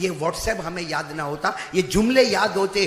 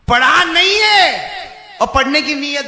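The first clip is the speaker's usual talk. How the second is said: shouted rebuke; 9 semitones higher, 11 dB louder than usual. Real, not acted. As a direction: shout the verdict at the congregation. Preacher shouts answer to own question.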